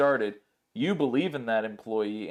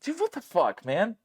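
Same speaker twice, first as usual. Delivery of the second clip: outraged disbelief; 10 semitones higher than usual. Retold or imitated. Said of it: "and I'm like".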